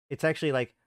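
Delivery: ordinary talk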